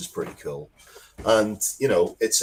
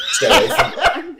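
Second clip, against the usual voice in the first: laughing warning; 9 dB louder than usual. Real, not acted.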